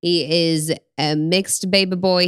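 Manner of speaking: ordinary delivery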